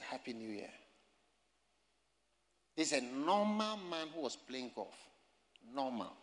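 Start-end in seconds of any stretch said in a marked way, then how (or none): 0.0-0.7 soft, low, retold greeting; quiet, low, timid mock greeting; meek greeting. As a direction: say it small, avoiding trouble. Timid greeting instead of preaching.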